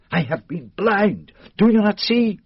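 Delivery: rising to a loud, high outburst; excited realization. Acted. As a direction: burst out as the truth hits you. Poirot realizes his mistake, agitated.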